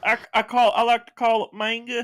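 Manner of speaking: high drawling southern guy; southern guy impression. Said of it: "That imitation's too good".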